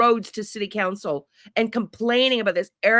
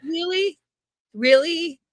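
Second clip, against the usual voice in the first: higher mock-incredulous "Really?"; sarcastic disbelief; 8 semitones higher than usual. Real, not acted. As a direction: act stunned by something obvious. Mocking the sheriff's clip.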